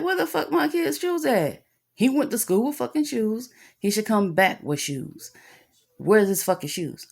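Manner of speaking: high-pitched indignant demand, easing toward the end; pissed-off parent. Retold or imitated. Parent demanding answers about a child's missing shoes, pissed off. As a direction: start high and outraged, then come down insistently. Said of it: "really pissed me the fuck off".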